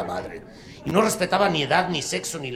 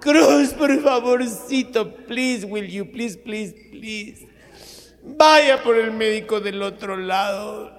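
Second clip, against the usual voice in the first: melodramatic begging; 8 semitones higher, 5 dB louder, slower than usual. Acted. Mother begs in soap.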